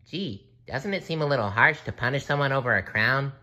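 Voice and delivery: high, quick cartoon voice; high-pitched questioning cartoon hero; SpongeBob voice. A narrator's reading. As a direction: ask it in a high, hurried cartoon voice. SpongeBob questions the king's punishment.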